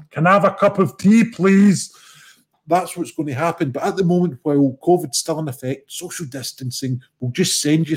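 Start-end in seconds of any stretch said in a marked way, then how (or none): 0.1-1.9 raised, higher, louder demand for tea; calling at a window. Acted. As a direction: call it through someone's window. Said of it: "chapping their window and saying".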